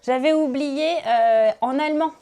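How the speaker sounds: usual speaking voice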